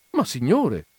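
protest, mildly affronted; indignant protest. A narrator's reading. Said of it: "protestò". (protesta indignata)

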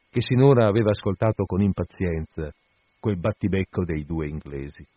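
usual speaking voice

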